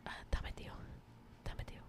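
whispering the name to herself; muttered whisper. Real, not acted. Whispered to herself while recalling the plant name. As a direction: mutter it under the breath, trying to remember.

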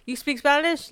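high, hesitant retold question; curious guy, imitated. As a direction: imitate a guy asking tentatively. Retelling a guy's tentative question.

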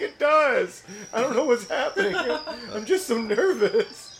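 high, loud, laughing nervously. Retold or imitated. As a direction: giggle through the nerves. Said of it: "She's like".

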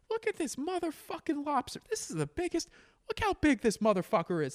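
very high, thin-volume mock holler; imitated yelling. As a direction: imitate an excited yeller without real volume. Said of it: "like, just yelling".

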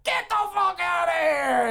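high, drawn-out yell; bellowed dismissal. Acted. Boss kicking Buck out, bellowing. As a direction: throw him out with a mock-furious holler.

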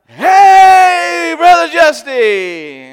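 calling out loud and high, stretched; loud friendly greeting. Retold or imitated. Neighbors calling out a loud stretched greeting. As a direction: hail him loudly from across the street. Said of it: "they're like".

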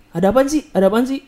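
imitating dad's high-pitched questioning. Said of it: "dengan nada tinggi gitu".

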